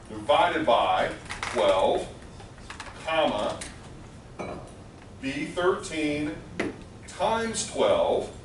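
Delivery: slow letter-by-letter read-out; dictating slowly. Shown as a demonstration. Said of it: "let me read it out".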